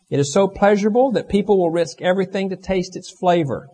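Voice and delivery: loud, high, impassioned pulpit delivery; full-force preaching. Real, not acted. Preacher telling the congregation sin is pleasurable for a short time, full-force preaching. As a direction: preach it at full voice, pitch soaring.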